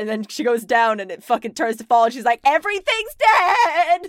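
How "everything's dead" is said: high slow laughing shout; dismayed shout. Retold or imitated. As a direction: wail it in laughing despair. Druid dismayed at autumn.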